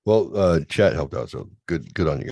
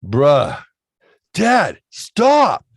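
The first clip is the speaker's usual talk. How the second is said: much higher, loud mock yell; upset son yelling; 11 semitones higher, 9 dB louder, much slower than usual. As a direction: shriek it like an annoyed teen. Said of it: "He's getting upset".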